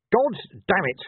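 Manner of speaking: high, exasperated outburst; shout. A narrator's reading. Officer loses patience with his corporal, high shout. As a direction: burst out, unable to hold it back. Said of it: "shouted Vimes, unable to stop himself".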